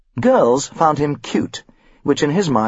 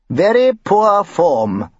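high, very slow, pompous verdict; stuffy form master; 7 semitones higher, 3 dB louder, much slower than usual. A narrator's reading. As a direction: drawl it like a fussy old schoolmaster. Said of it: "the form master had concluded".

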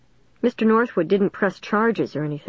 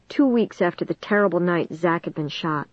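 ordinary delivery

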